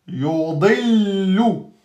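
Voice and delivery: Yudillu drawn out slowly; careful teacher recitation; slow model recitation. Shown as a demonstration. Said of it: "model recitation of word".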